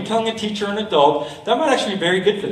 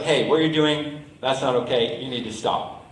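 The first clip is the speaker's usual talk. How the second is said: low, soft, composed objection; understated demo of calm confrontation; calm firmness; 5 semitones lower, 5 dB quieter than usual. Shown as a demonstration. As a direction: tell them to stop, staying calm and quiet. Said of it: "stay calm and just be like".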